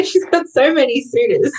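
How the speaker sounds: ordinary talk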